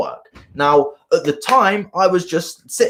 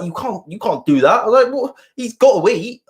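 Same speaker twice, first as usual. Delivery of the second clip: indignant protest; 7 semitones higher than usual. Retold or imitated. Retelling protest to his dad.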